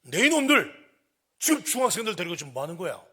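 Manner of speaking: loud, high outraged yell; furious scolding shout; angry shout. Retold or imitated. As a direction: bellow at them to stop right now. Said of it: "화가 나 소리쳤어요".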